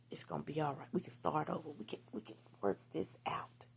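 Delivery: retold gentle comforting; soft reassurance. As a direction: soothe him quietly, letting the voice sink. Reassuring partner in retold thoughts.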